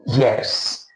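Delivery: normal talking voice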